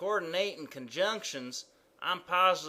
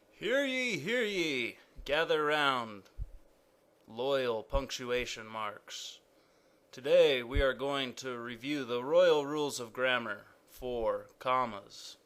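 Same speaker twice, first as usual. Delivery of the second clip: a town crier king calling his subjects to gather; royal proclamation; 3 semitones lower than usual. Acted. King summons the punctuation marks, proclaiming grandly. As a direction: ring it out like a herald, slowing on the last words.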